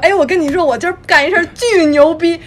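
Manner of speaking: high, loud, excited brag; excited bragging. Retold or imitated. Speaker bragging excitedly to her southern boyfriend, high and loud. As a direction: burst out with big news. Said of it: "我特别激动".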